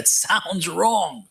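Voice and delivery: amused, very slow stress on "sounds WRONG"; emphatic repeat. Real, not acted. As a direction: draw out SOUNDS WRONG to hammer it home. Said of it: "Let me repeat that".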